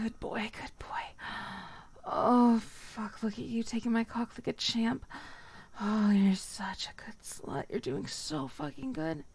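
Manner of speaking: ordinary talk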